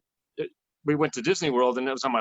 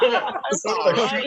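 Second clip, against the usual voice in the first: laughing, hammed-up Australian accent, much higher and louder; mock Australian accent; 8 semitones higher, 6 dB louder, much slower than usual. Musician replaying his reply to fans in a mock Australian accent. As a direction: drawl it slowly like a joking Aussie. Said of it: "He's Australian".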